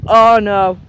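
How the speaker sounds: ordinary talk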